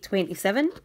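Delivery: usual speaking voice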